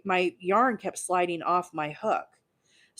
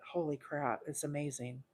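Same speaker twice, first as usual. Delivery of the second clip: ordinary talk, nothing marked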